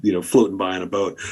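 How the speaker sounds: usual speaking voice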